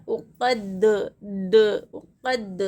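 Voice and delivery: उक़द repeated, the d isolated and bounced as "duh"; qalqalah. Shown as a demonstration. Teacher answers how qalqalah is done, bouncing the final d. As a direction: pop the final d of उक़द into a brief echo. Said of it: "कैसा करना चाहिए खलखला?".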